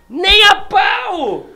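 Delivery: high, slow, loud shout; dramatic shout. Acted. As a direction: belt it out like a challenge. Challenge phrase shouted dramatically.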